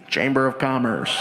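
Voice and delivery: low, deadpan official; fake official voice. Prankster poses as the Chamber of Commerce, low and deadpan. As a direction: disguise your voice as the chamber.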